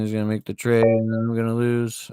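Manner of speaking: usual speaking voice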